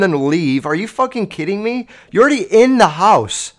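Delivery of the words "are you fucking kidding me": ordinary delivery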